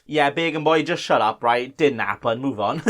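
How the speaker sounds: low mock heckler. Low second voice mocking himself.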